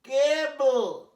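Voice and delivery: কেবল very slow, loud and high; slow careful pronunciation. Shown as a demonstration. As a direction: stretch কেবল long and loud. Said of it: "দেখছো, কে এ". (ধীরে জোরে কেবল)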